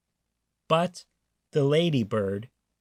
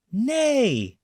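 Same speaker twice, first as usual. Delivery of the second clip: whinny like a horse with all her might; goose neighing; 10 semitones higher than usual. A narrator's reading. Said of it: "Then the goose said".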